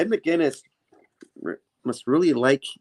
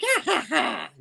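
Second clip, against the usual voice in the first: high-pitched mock goblin cackle; villain cackle; 3 dB quieter than usual. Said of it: "memories as Green Goblin".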